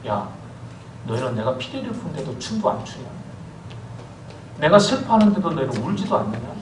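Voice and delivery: usual speaking voice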